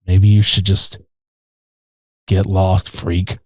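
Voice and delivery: low, calm and dismissive; calm brush-off. Retold or imitated. The carny tells the hero to leave, calm and low. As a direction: brush him off without heat, low. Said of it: "talking about as calmly as this".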